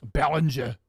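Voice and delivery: a mock German Behringer, the name said as Bälenscha; a hammed-up German accent on Behringer; terrible German accent. Shown as a demonstration. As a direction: Behringer said as a badly faked German. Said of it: "That's a terrible accent".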